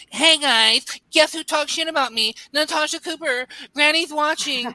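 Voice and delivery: high-pitched mock livestreamer whine; mocking streamer impression. Panelist mocks a rival's daily livestream gossip, high mocking voice. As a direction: put on a high, gossipy streamer voice to ridicule him. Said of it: "I can't every day get on the internet".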